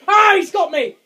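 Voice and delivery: high, loud cry of fright; caught, crying out in real fear; panicked yell. Real, not acted. Gamer caught by the monster, yelling in fright.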